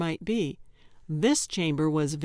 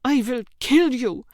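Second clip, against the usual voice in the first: furious threat; 6 semitones higher, 6 dB louder than usual. Acted. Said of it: "hissed Yelda through gritted teeth".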